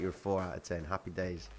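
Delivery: ordinary delivery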